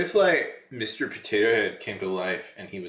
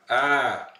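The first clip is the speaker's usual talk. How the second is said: sudden loud ah to startle; startling shout; 5 semitones higher, 7 dB louder than usual. Pretend. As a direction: burst out to make them jump. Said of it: "I'm gonna startle you at some point".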